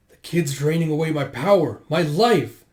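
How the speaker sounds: touch of panic, pushing up on the last words; mild alarm. Acted. Man fearing the kid drains his life, alarmed. Garo fears losing power and life.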